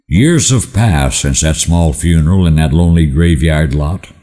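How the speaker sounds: usual speaking voice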